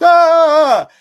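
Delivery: very high, loud sudden scream; jump-scare yell at full pitch; mock scare scream. Chainsaw man scaring kid in haunted house, high yell. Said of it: "some guy jumped out of a corner with a chainsaw".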